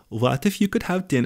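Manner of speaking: put-on first botched stab at a Dutch accent; horrible Dutch accent. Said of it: "is that my Dutch? That's horrible".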